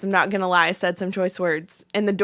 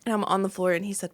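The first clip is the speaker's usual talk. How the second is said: ordinary talk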